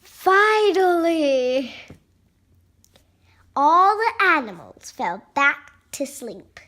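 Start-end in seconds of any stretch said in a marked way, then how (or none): 0.0-1.7 relieved. Acted. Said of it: "he's like".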